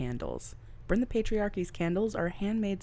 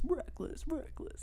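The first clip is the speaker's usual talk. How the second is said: fading echo; 10 semitones higher, 7 dB quieter than usual. Pretend. Echoing the word reckless.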